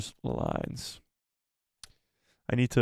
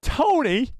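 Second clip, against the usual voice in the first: calling out; 13 semitones higher, 4 dB louder than usual. Real, not acted. Streamer calls out to donor.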